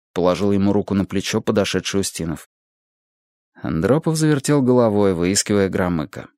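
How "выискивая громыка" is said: ordinary delivery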